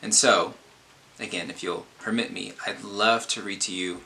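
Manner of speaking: ordinary delivery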